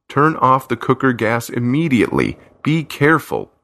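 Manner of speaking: firm urgent order; insist, a stranger giving a warning; commanding. A narrator's reading. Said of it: "the voice orders".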